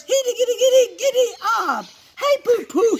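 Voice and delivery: high, loud, galloping chatter; boisterous high-pitched call; excited cousin. Acted. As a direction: whoop it out like a rider. Cousin rides in like a horseman.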